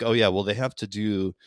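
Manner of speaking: ordinary talk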